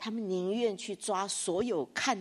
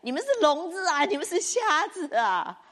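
higher, louder taunting call, last line slowed; mock rebuke; 7 semitones higher, 5 dB louder than usual. Isaiah sent to tell the people, mock rebuke. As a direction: jeer at them, half laughing. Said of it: "他是要去跟这些人讲".

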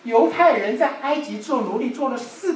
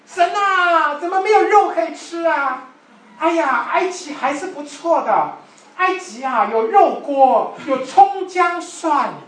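whining complaint; 7 semitones higher, 5 dB louder, slower than usual. Retold or imitated. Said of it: "就开始抱怨".

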